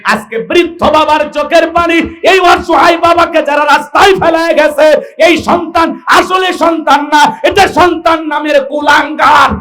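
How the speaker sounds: high, loud, hammering preaching; full-force denunciation. Acted. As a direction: denounce them to the crowd at full voice. (উঁচু গলায় তীব্র ওয়াজ)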